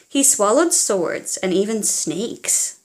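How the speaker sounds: ordinary delivery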